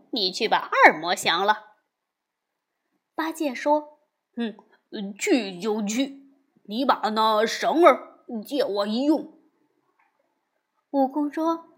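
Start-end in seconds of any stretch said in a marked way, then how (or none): 4.4-9.4 lightly voiced lazy pig monk; a hint of grumbling Bajie; Bajie's voice. A narrator's reading.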